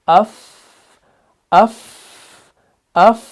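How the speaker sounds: "अफ" with a long whispery f tail; hams demonstration. Shown as a demonstration. Teacher showing that this is hams, "अफ" released in breath. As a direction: keep air flowing after "अफ". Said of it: "तो यही हम्स है".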